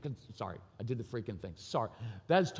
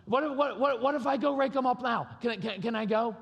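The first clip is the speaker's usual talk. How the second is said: much higher, eager, stumbling plea; pleading kid; 9 semitones higher, 3 dB louder than usual. Retold or imitated. Son asking his dad to let him rake later, pleading. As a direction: beg like a kid trying to bargain.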